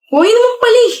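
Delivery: sharp high scoff at her refusal; mocking scoff. Boyfriend scoffing high at girlfriend who refuses him.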